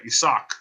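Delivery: Isaac with an "ee" start and "sock" ending; Spanish pronunciation. Shown as a demonstration. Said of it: "That's how you say it".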